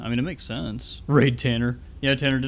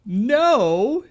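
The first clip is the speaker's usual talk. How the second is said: mock disbelief; 11 semitones higher, 6 dB louder than usual.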